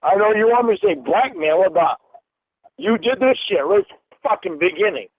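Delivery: loud, furious accusation; angry shout. Real, not acted. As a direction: let the anger burst out.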